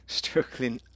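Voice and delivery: laughs through the word struggling; a tired laugh on struggling. Real, not acted. Streamer admits he is struggling today, laughing.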